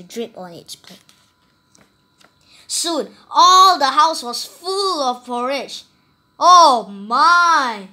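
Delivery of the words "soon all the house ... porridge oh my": loud, slow, awed telling; storyteller playing up the disaster; dramatic alarm. A narrator's reading. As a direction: make the flood sound enormous. House fills with porridge.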